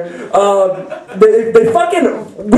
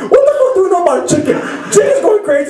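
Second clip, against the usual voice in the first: laughing shout; 11 semitones higher, faster than usual. Real, not acted. Laughing at own city ignorance.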